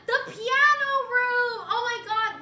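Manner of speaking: very loud, high, stretched-out exclamation; excited outburst. Real, not acted. Streamer reacting to the piano room, excited yell. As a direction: burst out loudly after a gasp.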